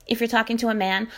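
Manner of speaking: ordinary delivery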